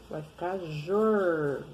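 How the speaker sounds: "jor" slow, tongue curled back on the R. Shown as a demonstration. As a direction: stretch "jor", curling the R. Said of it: "Dobrou a língua". ("jor" devagar, língua dobrada)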